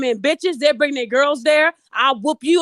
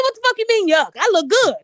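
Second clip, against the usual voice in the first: indignant retort; 8 semitones higher than usual. Retold or imitated. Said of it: "I said".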